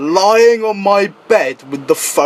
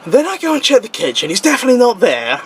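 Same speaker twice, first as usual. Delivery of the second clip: ordinary talk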